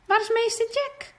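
where did he go?; surprised question. Acted. Renske asking classmates where the teacher is, surprised.

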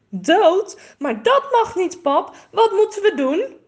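high, loud, frightened pleading; alarmed. A narrator's reading. Girl, shocked, pleads with her father to save the bird, high and alarmed. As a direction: panic at the thought the bird will die. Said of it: "kijkt haar vader geschrokken aan".

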